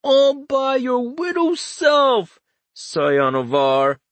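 oily condescension. Acted. Richter mocking his friend with slow oily condescension. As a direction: drip fake support over every word. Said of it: "the oiliest supportive condescension".

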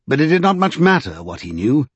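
ordinary delivery, nothing marked

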